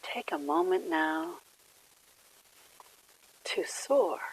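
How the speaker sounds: gentle phrases spaced by long silences; guided meditation. Real, not acted. Leads a quiet meditation.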